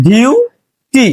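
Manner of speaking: ডিউটি drawn out slowly as ডিউ, then টি; teacher's slow syllable-by-syllable ডিউটি; split into syllables. Shown as a demonstration. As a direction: break ডিউটি in two, a long ডিউ and a separate টি. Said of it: "syllable করি বা ভাঙি".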